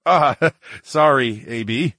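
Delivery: short laugh breaking into sorry; chuckled apology. Acted. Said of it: "I chuckled".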